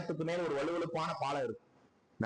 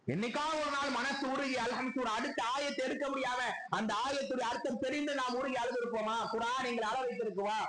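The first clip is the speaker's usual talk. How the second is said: high-pitched, sustained sermon appeal; impassioned preaching; 8 semitones higher than usual. Real, not acted. Preacher asks congregation if the Quran moves them, impassioned. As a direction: plead with the congregation on a raised pitch.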